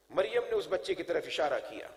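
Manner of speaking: ordinary delivery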